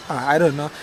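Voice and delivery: ordinary delivery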